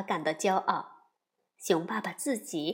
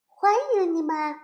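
higher beaver greeting; 6 semitones higher, 4 dB louder than usual. Acted. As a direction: welcome them as a small beaver.

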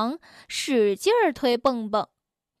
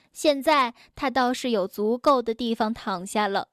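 plain reading voice

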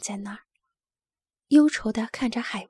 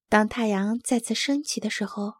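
plain reading voice

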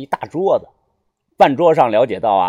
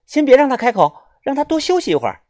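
high-pitched female doctor voice; young woman doctor; 10 semitones higher, faster than usual. A narrator's reading. Doctor telling friends to let the patient rest, high voice. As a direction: instruct firmly in a high voice.

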